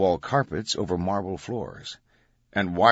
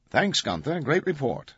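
news anchor voice; 8 semitones higher than usual. Retold or imitated. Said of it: "He could hear the news anchor already".

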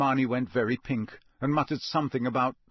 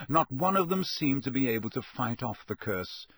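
ordinary delivery

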